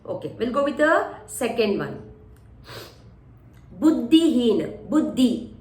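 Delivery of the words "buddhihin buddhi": "Buddhihin" drawn out, ddh held; slow demonstration. Shown as a demonstration. Teacher slowly saying the word for students.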